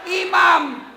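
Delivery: loud, punchy rhetorical questions; emphatic shout. Real, not acted. Preacher drilling the congregation on who is at fault, loud emphatic. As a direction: hammer each question at the crowd. Preacher asks, answers loudly.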